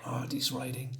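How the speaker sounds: real hushed whisper under the breath; whispered aside to himself. Real, not acted. Aside while looking at the comic.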